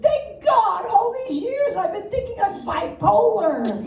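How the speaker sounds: a hammed-up sigh of relief at the news; relieved thought. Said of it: "I think".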